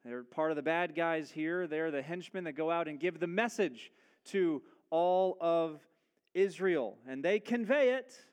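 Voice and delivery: usual speaking voice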